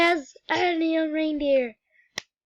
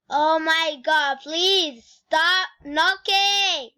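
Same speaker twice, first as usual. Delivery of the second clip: loud and slow, fed up; yelling at siblings to stop knocking, exasperated; annoyed shout; 2 semitones higher, 6 dB louder, slower than usual. Real, not acted.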